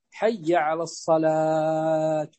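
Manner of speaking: drawn-out حي على الصلاة ending in "tu"; mispronounced call to prayer. Shown as a demonstration. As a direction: say الصلاة slowly with a long aa and a voiced final ضمة on the taa. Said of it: "هكذا". (نطق "الصلاة" ممدودة بضم التاء في آخرها)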